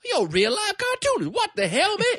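very high Brooklyn-Southern guy voice; squeaky Brooklyn-Southern accent. Retold or imitated. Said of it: "he goes".

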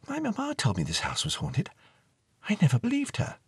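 put-on a girl telling the ghost what mamma said; young girl. Girl's voice in story.

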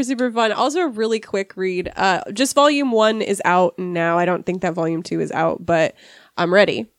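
ordinary talk

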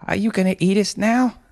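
high, anxious question; fearful mother. Acted. Mother fears being eaten.

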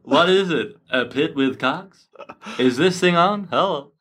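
high, slow stand-up comic voice; hammy eighties stand-up delivery; eighties comedian impression. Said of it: "nineteen eighties, uh, comedian impression".

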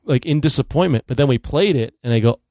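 ordinary delivery

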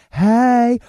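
high, loud sketch-character squawk; hammed-up comedy character voice; Wanda. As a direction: belt it out as a comic impression. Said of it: "do you remember in Living Color, Wanda?".

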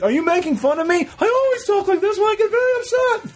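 Tina Fey voice. Retold or imitated. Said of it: "Tina Fey doing Jerry Seinfeld".

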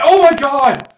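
high, very loud mock shriek of shock; horrified screaming. Retelling a comedy sketch listener reaching the Red Wedding, horrified screaming. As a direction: scream it out, stretching the last cry.

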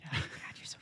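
fast teasing whisper. Real, not acted. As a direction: hiss it under your breath. Teasing a guest under breath.

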